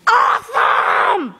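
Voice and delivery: high, drawn-out cheer; slow, high thrilled cry of awesome; excited shout. Real, not acted. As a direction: stretch it out slowly with delight. Thrilled at game moment.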